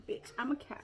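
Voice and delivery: whispered with a sigh; breathy whisper. Real, not acted. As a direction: sigh it out, amused. Reading the cup aloud.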